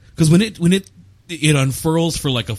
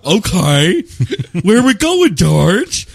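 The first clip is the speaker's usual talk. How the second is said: goofy, dim-witted and loud; dopey mock voice; 4 dB louder, much slower than usual. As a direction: play it dumb and eager. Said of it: "And Billy Crudup goes".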